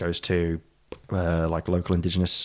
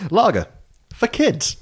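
laughing; slower than usual. Real, not acted. Laughing at the safety can joke.

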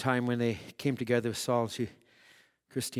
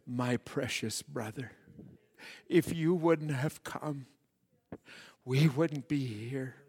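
hushed retold greeting; 5 dB quieter, slower than usual. Retells souls greeting the prophet.

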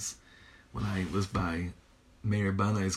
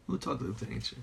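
much softer, as if to oneself; hushed repeat; 7 dB quieter, faster than usual. Real, not acted. Teacher marvelling at talking to nature, hushed. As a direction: drop the volume on the repeated question. Repeats amazed question to himself.

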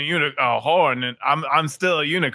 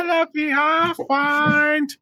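high, slow squeaky mushroom speech; tiny mushroom voice; 11 semitones higher, slower than usual. Acted. Mushroom greeting the party in a slow, high squeak.

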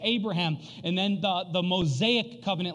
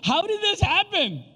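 baffled disbelief; 8 semitones higher than usual. Retold or imitated. Dad reliving his gift blunder, baffled disbelief. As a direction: exclaim it in exasperated disbelief, stretching the key words. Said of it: "I just remember being like".

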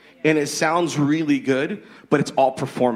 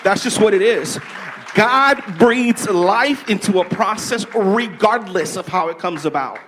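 preaching; 7 semitones higher, 4 dB louder than usual. Real, not acted. Preaching with passion to the host.

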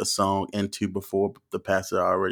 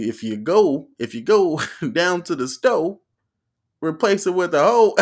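rising to a high mock shout; preacher rhyming his way out; 8 semitones higher, 6 dB louder than usual. Preacher rhyming his way out, mock preaching. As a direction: build the rhyme to a loud pulpit finish. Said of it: "He was trying to fucking rhyme his way out".

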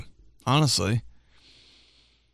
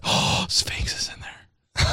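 whisper; 4 dB quieter, slower than usual. Retold or imitated. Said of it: "We're like".

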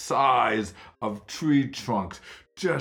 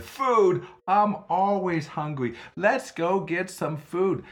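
higher, louder, perky; eager boy; 10 semitones higher, 4 dB louder than usual. Acted. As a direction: perk up at the word food. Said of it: "perked right up".

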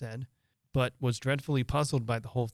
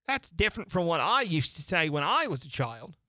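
higher beast voice; a scoffing griffin; 4 semitones higher than usual. Acted. Griffin remarks.

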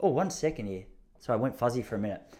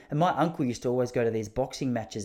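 ordinary delivery

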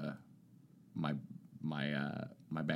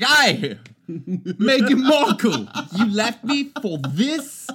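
put-on high, loud terrible British accent; jilted British prince, outraged and hammed up; slow mock-British yell of betrayal; Prince Harry; 13 semitones higher, 11 dB louder, slower than usual. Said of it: "speaking in a terrible British accent".

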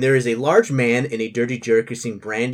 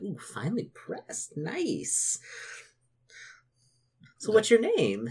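lightly voiced coy woman, higher and soft; 8 semitones higher, 6 dB quieter, slower than usual. Woman touching the monk's robes, coy. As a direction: coo it with a touch of flirtation. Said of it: "She smiles coyly at you".

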